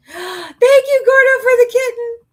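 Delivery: high, loud excited squeal of thanks. Real, not acted. Thanking a fan for a kitten, delighted squeal.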